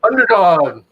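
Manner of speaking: drawn-out announcer call; vintage cartoon voice. Quoting cartoon hero name.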